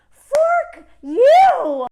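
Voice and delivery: loud, high furious yell; furious shout. Real, not acted. Vlogger yelling at the billionaire, furious. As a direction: explode at him. Outburst at billionaire's pandemic profit.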